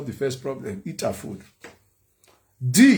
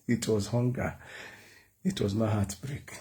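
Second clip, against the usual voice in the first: usual speaking voice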